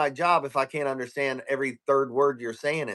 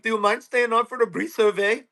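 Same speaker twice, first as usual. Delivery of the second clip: mock Indian accent; 5 semitones higher than usual. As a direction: imitate an Indian agent's polite request. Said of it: "he's like".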